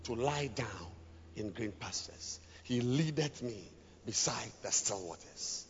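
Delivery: soft, hushed reading of the psalm, fading to a whisper; soft scripture reading. Acted. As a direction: read it gently, like a lullaby over the music. Reading psalm over music.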